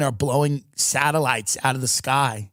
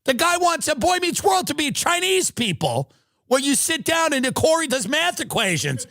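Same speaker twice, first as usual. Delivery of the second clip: shrill comic rant; 11 semitones higher than usual. Real, not acted. High-pitched comic rant about Friends.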